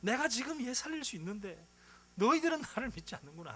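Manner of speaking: high, anguished rebuke of the unbelievers; a preacher voicing Jesus's grieving lament, pitched high; grieved Jesus. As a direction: voice Jesus heartbroken and indignant at their disbelief. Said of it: "비통해 하시면서 우시는 겁니다".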